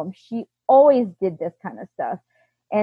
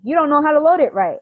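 higher-pitched mock criticism; mimicked nagging; 6 semitones higher, faster than usual. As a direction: mimic his repeated complaint. Husband's repeated criticism, mimicked.

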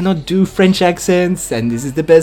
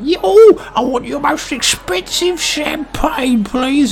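high-pitched diner voice; eager customer; 8 semitones higher than usual. Acted. Diner ordering expensive champagne from waiter, high eager customer voice.

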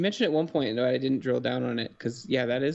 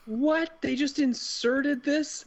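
incredulous; 13 semitones higher, much slower than usual. Real, not acted. Incredulous at plot twist.

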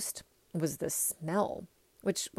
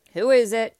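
much louder, higher demand; Ralph over intercom; 5 semitones higher, 11 dB louder than usual. A narrator's reading. Ralph answers the gate intercom, loud and brusque. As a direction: bark the question into an intercom. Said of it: "said Ralph's voice over the intercom".

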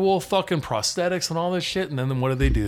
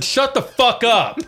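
slow, loud, higher shouted order; retold shout; 10 semitones higher, 7 dB louder, slower than usual. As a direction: bark it out to silence the guys behind. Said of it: "I said".

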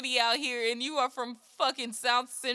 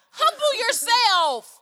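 scolding; 9 semitones higher, 7 dB louder, much slower than usual. Pretend. Scolding the pretentious singer.

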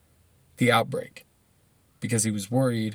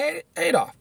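mock pleading voice; 13 semitones higher than usual. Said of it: "Terrible impersonation".

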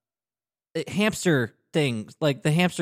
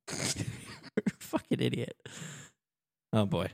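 laughing mutter; 8 dB quieter, much slower than usual. Real, not acted. Scolds himself laughing.

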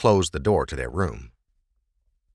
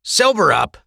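raised, scornful sneer; 11 semitones higher, 6 dB louder than usual. Acted. Blackcoat dismissing the drunk prisoner, sneering. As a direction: spit it at him with contempt. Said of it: "the Blackcoat sneered".

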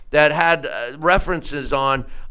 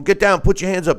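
aggressive command; 6 semitones higher, faster than usual. Retold or imitated. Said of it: "he's going".

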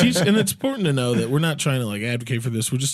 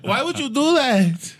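high, slow, hammed-up Greek accent; 12 semitones higher, slower than usual. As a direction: ham up a Greek guy asking why. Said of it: "There's Greek".

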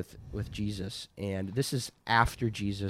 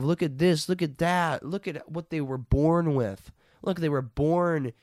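high, complaining imitation of the envious; gasp, then whine through a list; mock envy; 5 semitones higher, 5 dB louder than usual. Mimicking people envying others.

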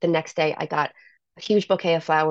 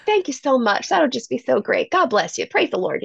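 put-on light Southern accent, grateful and a bit higher; Southern preacher dad; 6 semitones higher than usual. Dad thanking the pizza guy, light Southern preacher accent. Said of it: "he's a preacher".

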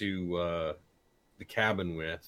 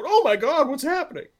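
high, loud mimicked scream; mock panic; 8 dB louder than usual. Podcaster mimics co-workers startled by an exploding bottle, mock panic. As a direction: squeal it like an alarmed bystander.

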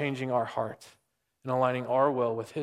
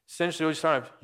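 ordinary delivery

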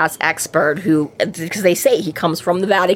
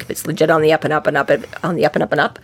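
rapid babbling Harry; 3 semitones lower, much faster than usual. Retold or imitated. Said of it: "He's like".

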